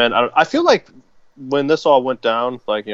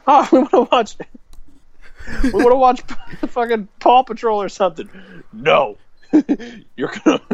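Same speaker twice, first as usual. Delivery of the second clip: put-on very high whiny child; high mock nephew voice; kid voice; 12 semitones higher than usual. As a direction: whine like a little kid. Voicing nephews.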